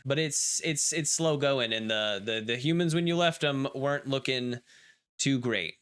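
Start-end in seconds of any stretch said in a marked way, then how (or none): none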